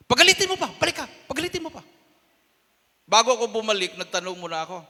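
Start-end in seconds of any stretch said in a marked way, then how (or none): 0.1-1.8 much higher, fast eager orders; urgent command. Retold or imitated. Pastor urging Danny to provoke them more, fast and high. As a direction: push him to go back now.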